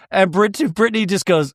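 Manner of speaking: normal talking voice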